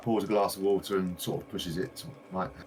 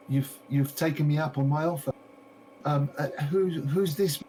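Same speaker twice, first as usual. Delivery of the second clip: higher, eager shopkeeper voice; gushing shopkeeper lady; 8 semitones higher than usual. Acted. A game master voicing the shopkeeper greeting a visitor, high and eager. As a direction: play the delighted lady greeting her customer.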